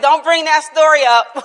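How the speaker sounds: laughing protest. Real, not acted.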